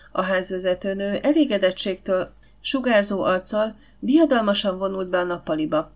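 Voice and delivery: usual speaking voice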